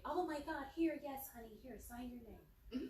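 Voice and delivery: put-on eager-to-please voice; mock fawning clerk. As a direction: imitate a gushing clerk welcoming a favourite customer.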